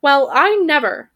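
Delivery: high, loud huffy bird; scandalized squawk; indignant raven. Acted. Offended raven voice.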